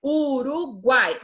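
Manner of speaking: slow, syllable by syllable; teacher spelling out country names for pupils. Shown as a demonstration. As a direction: split each word into its syllables. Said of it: "Guai é uma sílaba só".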